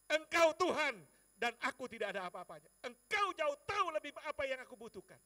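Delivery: loud, high prayer declaration; fervent cry to God. Retold or imitated. Preacher confessing that God knows his need, fervent shout. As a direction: cry out your faith with full voice.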